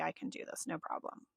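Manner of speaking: breezy confidence. Retold or imitated. Herself before the trip, quietly sure it will be easy.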